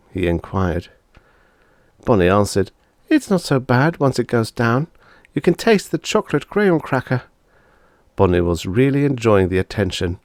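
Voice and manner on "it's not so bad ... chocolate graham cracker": high, lightly voiced girl; a little girl reporting the taste; girl's voice. Sister telling brother about the taste, high girl voice. Bonnie's lines.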